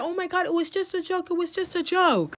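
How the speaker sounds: high-pitched mock protest; imitated yelling. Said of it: "Everybody starts yelling".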